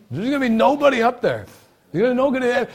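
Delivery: loud, high, forceful denial; emphatic preaching. Real, not acted. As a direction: thunder it out at a high pitch. Preacher insists, refuting the quote.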